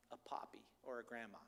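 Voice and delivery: whispered aside; hushed, intimate whisper. Real, not acted. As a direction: drop to a secretive whisper. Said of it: "whispered aside".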